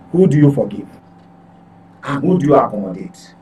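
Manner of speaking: ordinary delivery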